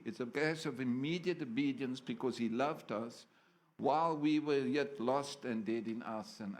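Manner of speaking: ordinary talk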